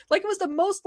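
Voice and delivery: usual speaking voice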